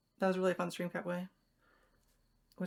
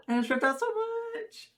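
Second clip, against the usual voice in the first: high, drawn-out gasping wail; gasp, then squeal it slowly; distressed squeal; slower than usual. Real, not acted. Hair rips while untangling it.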